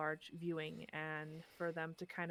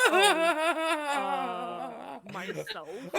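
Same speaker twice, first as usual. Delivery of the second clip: laughing sheepish answer; 9 semitones higher, 13 dB louder, much slower than usual. Retold or imitated. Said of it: "And it's like".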